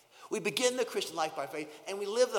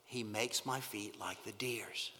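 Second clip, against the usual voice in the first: soft whisper; 7 semitones lower, 8 dB quieter, slower than usual. Pretend. Closing verse read softly.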